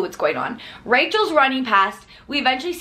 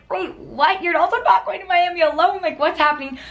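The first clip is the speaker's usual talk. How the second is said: higher-pitched incredulous questions; mock disbelief; 8 semitones higher than usual. Friends at the table reacting to the news, higher and bewildered. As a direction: sound baffled as friends vanish. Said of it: "And we're all like".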